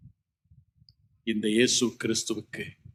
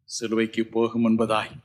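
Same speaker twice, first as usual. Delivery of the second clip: ordinary talk, nothing marked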